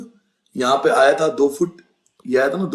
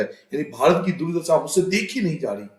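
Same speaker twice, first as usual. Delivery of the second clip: normal talking voice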